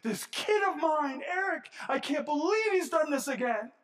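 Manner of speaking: hammed-up fed-up parent throwing up his hands; exasperated heavenly father. Mock God fed up with his son Eric, high and loud. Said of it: "throw his hands up in heaven and go".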